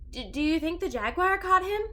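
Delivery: put-on a frightened young girl asking; scared little sister.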